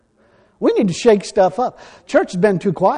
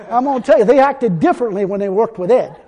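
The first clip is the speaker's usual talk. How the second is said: ordinary talk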